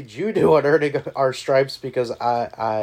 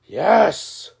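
excited shout; 7 semitones higher, 6 dB louder than usual. Real, not acted. Celebratory shout.

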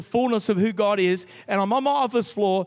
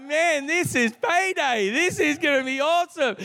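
excited; 7 semitones higher than usual. Retold or imitated. Imagining a big payday.

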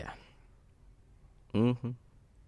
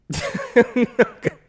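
a sudden burst of high laughter; loud high laugh; 13 semitones higher, 6 dB louder than usual. Real, not acted. Host bursting into laughter at the guest's speech. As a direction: crack up at the moving speech.

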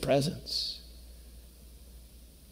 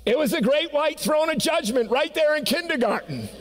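laughing while reading; 12 semitones higher than usual. Real, not acted. Reader laughing at the story.

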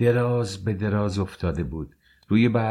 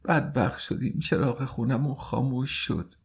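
higher, softer mild keening; lamenting; 6 semitones higher, 4 dB quieter than usual. A narrator's reading. Mother lamenting the house's light has gone out, mild wail. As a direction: mourn it out gently, pitch lifted.

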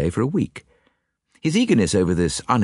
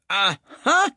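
angry cry; 12 semitones higher than usual. A narrator's reading. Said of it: "cried Poirot angrily".

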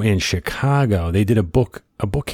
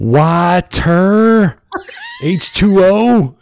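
high, loud, slow spelling-out shout; shouted explanation; 11 semitones higher, 7 dB louder, much slower than usual. Retold or imitated. Explaining water to the bartender, slow high shout. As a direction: yell it slowly like she's dim. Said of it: "I'm screaming at the bartender".

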